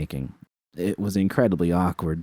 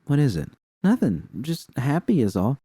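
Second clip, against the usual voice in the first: lightly raised voice of a friend; a hint of a bright girlfriend; Roxanne's voice; 7 semitones higher than usual.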